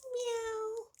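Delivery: high mewing meow; high cat voice meowing. Acted. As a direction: mew like a little cat. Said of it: "What does the cat say?".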